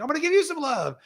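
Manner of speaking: high, swooping exuberant offer; re-enacted stage enthusiasm; showy affection. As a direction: sweep the pitch up high, playing to the crowd. Re-enacting rallying the audience.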